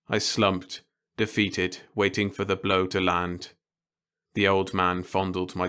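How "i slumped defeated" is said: ordinary delivery, nothing marked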